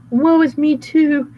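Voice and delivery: high, loud fake boo-hoo; mock moaning. Wolf in disguise fake crying, hammed-up. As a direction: ham up the sobbing.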